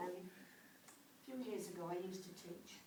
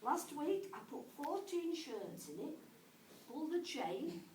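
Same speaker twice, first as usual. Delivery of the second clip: higher, louder recital of a letter; comic letter reading; 4 semitones higher, 9 dB louder than usual. Acted. Mum writes a silly letter to her son, comic letter reading. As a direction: read it out line by line, pausing for each punchline.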